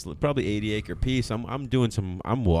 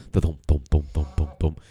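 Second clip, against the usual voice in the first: 1 imitated thumping; 6 semitones lower than usual.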